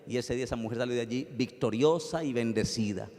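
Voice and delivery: ordinary delivery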